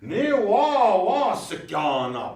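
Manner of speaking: "Ni wah" high and loud, then "wah, sikyo" drawn out slowly; emphatic example. Shown as a demonstration. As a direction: exclaim the phrase, then slow down on "sikyo". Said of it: "You say, you say".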